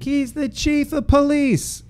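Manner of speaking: high, slow mock wife; wife telling the teen who he hit, high and slow; scolding wife. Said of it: "She's like".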